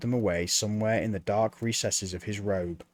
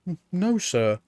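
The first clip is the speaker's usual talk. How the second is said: put-on higher, halting boy's reply; nervous stammer; 7 semitones higher, 3 dB louder than usual. A narrator's reading. Apprentice Mort answering Death, nervous stammer. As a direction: stumble over the no.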